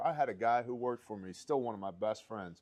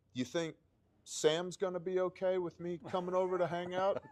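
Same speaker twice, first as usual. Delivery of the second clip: higher, timid voice of a nervous friend; sheepish friend; 9 semitones higher than usual. Retold or imitated. Joe nervously asks if the dad will accept him, sheepish higher voice. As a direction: ask it like a guy afraid of the dad.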